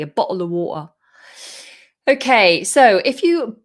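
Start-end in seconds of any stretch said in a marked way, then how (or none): 0.0-0.9 London accent, catch in the throat for T in "bottle"; southern London accent with dropped Ts; London glottal T. Shown as a demonstration. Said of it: "glottalizing the T".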